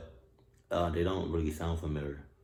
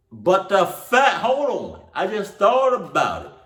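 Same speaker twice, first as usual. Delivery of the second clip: excited outburst; 12 semitones higher, 9 dB louder, slower than usual. Real, not acted. Sudden excited interruption.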